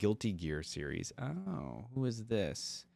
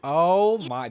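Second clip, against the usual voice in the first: shocked gasp; 7 semitones higher, 9 dB louder than usual. Real, not acted. Reacting to a harsh insult.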